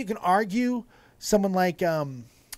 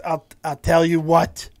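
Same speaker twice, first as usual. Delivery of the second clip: half-hearted Scottish attempt, punched louder; botched Scottish accent; 2 semitones lower, 6 dB louder than usual. Host quoting a Scottish wrestler's promo, botched Scottish accent. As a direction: try Scottish and not quite manage it. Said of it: "I can't do Scottish".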